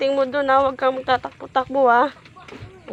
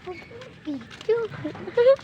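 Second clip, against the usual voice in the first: laugh; 11 semitones higher than usual. Real, not acted. Mother laughs at child.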